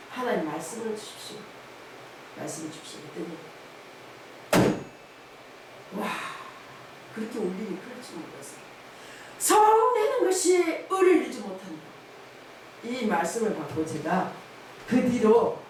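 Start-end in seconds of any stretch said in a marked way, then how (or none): 2.4-3.0 quiet plea. Retold or imitated. Repeated prayer, fading. 9.4-11.9 verse rung out high and loud; proclaimed verse. Retold or imitated. The preacher recites the verse God gave her, ringing and loud. Quoting the scripture received.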